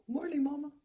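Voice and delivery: put-on high little baby voice; baby animal voice. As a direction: voice a sleepy baby orangutan. Voicing baby orangutan.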